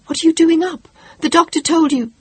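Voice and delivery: high, loud alarmed question; startled mother, alarmed. Acted. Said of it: "her mother said in alarm".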